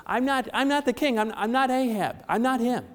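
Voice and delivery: high, loud frantic cry of innocence; panicked denial. Retold or imitated. Jehoshaphat to the chasing Syrian soldiers, panicked and loud. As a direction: yell it as soldiers close in.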